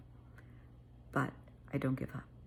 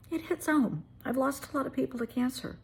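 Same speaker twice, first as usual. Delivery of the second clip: fighting back tears, higher; emotional; 9 semitones higher than usual. Real, not acted. Remembering lost friends, voice breaking with emotion. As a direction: voice pitched up and tight with tears. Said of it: "I get a little emotional about this".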